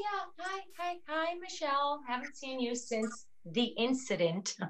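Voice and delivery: taken aback, barely voiced; stunned, quiet. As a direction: Linda stunned to hear from her half-sister. Awkward stunned greeting.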